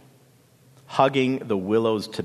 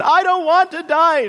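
very high, loud scream of refusal; 8 dB louder than usual. A narrator's reading. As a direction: scream in desperate refusal to die. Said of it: "she screamed".

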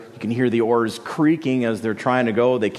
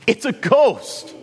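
high, drawn-out cry of terror; frightened cry; 9 semitones higher, slower than usual. Acted. Disciples struggling at the oars cry out, terrified. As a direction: wail it like men seeing a phantom. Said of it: "And they cry out".

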